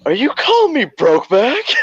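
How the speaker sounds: high-pitched incredulous squawk; hammed-up high, affronted voice; mock disbelief. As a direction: imitate his indignant question, close to laughing. Retold offended question over the radio.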